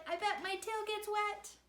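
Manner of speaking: high father pig; father's high line. A narrator's reading. Father decides to jump in, high voiced. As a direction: squeak it up high. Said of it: "He said".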